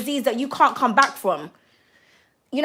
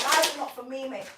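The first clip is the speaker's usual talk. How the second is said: ordinary delivery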